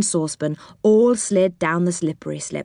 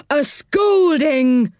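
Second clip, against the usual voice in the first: high, very drawn-out indignant echo; indignant washerwoman; 8 semitones higher, 3 dB louder, much slower than usual. A narrator's reading. Washerwoman echoes the threat from below, indignant and drawn-out. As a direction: sing out each word slowly and high in indignation. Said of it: "said Dame Washalot's voice".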